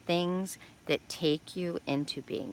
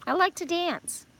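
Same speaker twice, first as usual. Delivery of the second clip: high, bright-eyed sudden idea; excited realization; 7 semitones higher than usual. Retold or imitated. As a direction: squeal it like a new discovery. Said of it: "I'm like".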